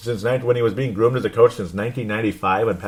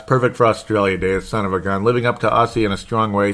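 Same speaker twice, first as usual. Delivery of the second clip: ordinary delivery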